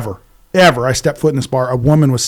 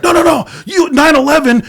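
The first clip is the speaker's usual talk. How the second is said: much higher, louder mock rant; heated outburst; 11 semitones higher, 3 dB louder than usual. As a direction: imitate the angry opponent, pitched up high. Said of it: "this fucking dude was getting heated".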